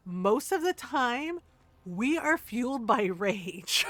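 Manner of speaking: slow, deliberate retort breaking into a laugh; measured comic declaration, laughing near the end; emphatic declaration. Retold or imitated. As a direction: spell it out slowly.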